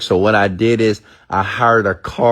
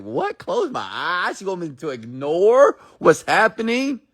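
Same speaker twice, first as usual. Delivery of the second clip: higher, incredulous listener; mock disbelief; 9 semitones higher, 3 dB quieter than usual. Speaker voices a skeptical listener told to close eyes, mock disbelief. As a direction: play the skeptic, baffled. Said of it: "You're like".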